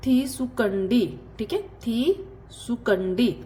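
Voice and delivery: थी सुकंडी said slowly; slow drill. Shown as a demonstration. Teacher drills Telugu for take slowly. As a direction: say थी सुकंडी deliberately. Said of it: "कुछ लेना किसी से".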